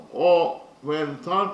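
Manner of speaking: "O velta" broken into slow, firm syllables. Shown as a demonstration. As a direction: count out "O velta" beat by beat. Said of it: "consists of three syllables".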